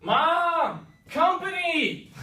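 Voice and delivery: high, loud drawn-out yell; yelling for mom. Acted. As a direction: holler up to mom, stretching the word out. Prince yells for mom.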